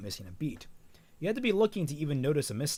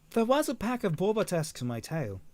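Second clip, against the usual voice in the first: higher-pitched go at Australian; lightly attempted Australian accent, pitched up; 5 semitones higher than usual. Velvet telling her partner she was followed, in attempted Australian accent. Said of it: "work on my Australian accent".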